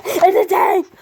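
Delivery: high, loud excited cry. Real, not acted. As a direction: announce it with a thrill. Excited toy reveal.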